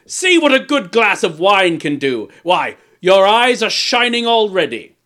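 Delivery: loud, high and exultant; gloating, boisterous king. A narrator's reading. King mocking the dwarf's shining eyes, boisterous. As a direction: crow over your victim heartily.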